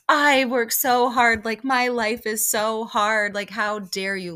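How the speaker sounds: sarcastic poor-me impression; mocking whine. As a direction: drag it out like a spoiled rich lady. Mocking rich women complaining.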